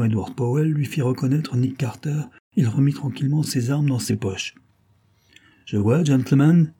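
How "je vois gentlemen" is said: slow, louder dry sarcasm; a touch of sarcasm, drawn out; sarcastic. A narrator's reading. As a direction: needle the gang with calm mockery. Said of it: "dit-il, sarcastique".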